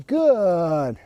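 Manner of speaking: long, evenly stretched "Good", falling steadily; drawn-out marker word. Shown as a demonstration. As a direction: stretch "Good" out slowly and let it glide down. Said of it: "That good means it's gonna go".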